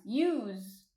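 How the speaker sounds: "Use" said as YOOZ; Jersey youse. Shown as a demonstration. Showing the short plural you, "Use" as YOOZ. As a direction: lengthen "Use" and end it on a buzzing z. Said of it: "or they'll just say".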